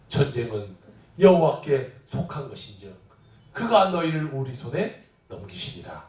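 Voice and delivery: low, measured recitation; solemn scripture reading. Acted. Preacher quoting David from Samuel, low solemn reading. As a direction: read the verse in a low register.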